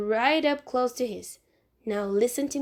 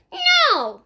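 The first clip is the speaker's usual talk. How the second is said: put-on high, loud child's refusal; defiant shout; 8 dB louder than usual. Fudge shouting down at Sheila, refusing to come down. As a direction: yell a stubborn no from the tree.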